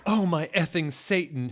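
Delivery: loud, high shocked exclamation; shouted. A narrator's reading. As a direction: cry out in alarm. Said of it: "Harry shouted".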